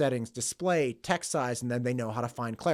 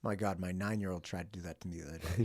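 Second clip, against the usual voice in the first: ordinary talk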